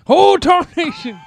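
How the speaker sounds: put-on high, slow old-timer exclamation; drawn-out cowboy oath; old prospector. Old cowboy bit continues.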